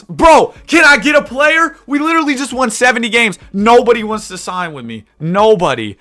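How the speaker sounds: loud, high disbelieving complaint; exasperated shout. Real, not acted. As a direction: yell out your frustration. No free agents will sign.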